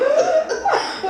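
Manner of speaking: high burst of laughter. Real, not acted. Laughing about crossing the fence.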